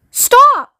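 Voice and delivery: very high, loud cry; screamed. A narrator's reading. Ground control interrupting Shanks's report, screamed. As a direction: scream to cut him off. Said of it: "screamed ground control".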